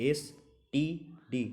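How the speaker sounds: এসটিডি read slowly, letter by letter; slow, spell out এসটিডি letter by letter. Shown as a demonstration. Teacher making students hear এসটিডি letter by letter, slowly. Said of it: "ওয়ার্ডের মতো করে উচ্চারণ করা যাচ্ছে না".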